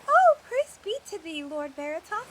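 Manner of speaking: overjoyed devotion; fervent praise. Acted. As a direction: burst into rapturous praise. Acolyte worships her god.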